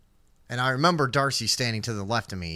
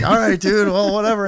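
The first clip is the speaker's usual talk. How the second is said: higher, louder laid-back dude; 8 semitones higher, 8 dB louder than usual. Retold or imitated. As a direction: shrug it off like a cop. Cops shrugging it off.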